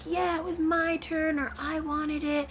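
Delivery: put-on high, slow, whiny little boy; mock toddler complaining to mommy; sulky child. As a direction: sulk like a hurt three-year-old. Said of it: "he's like".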